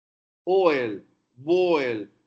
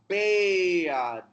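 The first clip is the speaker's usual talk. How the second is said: ordinary talk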